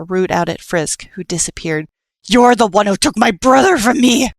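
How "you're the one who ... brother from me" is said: high, loud furious scream; screamed accusation. Acted. Nightmare screaming at Frisk over his brother, furious. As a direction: scream it at him with all your hatred. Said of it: "before he screamed".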